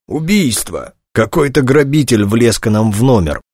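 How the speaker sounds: a touch of high, strained croak; hoarse croak. A narrator's reading. Choked man explains the shooting to intruders, slightly croaky. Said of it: "прохрипел Дронга".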